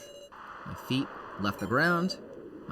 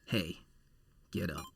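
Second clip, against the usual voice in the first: quiet, lower voice nudging someone awake; low soft wake-up; 6 semitones lower, 8 dB quieter than usual. Acted. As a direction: murmur to rouse a sleeper. Game guard waking the player.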